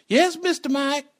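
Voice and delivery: put-on very high child voice; put-on high-pitched innocent reply; innocent little boy. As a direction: mimic a kid answering politely. Plays the boy answering innocently.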